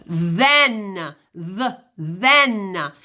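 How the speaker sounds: "then" and "the" with a buzzing, voiced th. Shown as a demonstration. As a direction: hum through the th in "then". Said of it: "the voiced sound is the".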